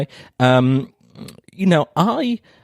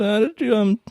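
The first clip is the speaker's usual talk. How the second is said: higher, tentative asking voice; hopeful question; 7 semitones higher than usual. Retold or imitated. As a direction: ask it hopefully, a bit higher. Retelling asking examiner nervously.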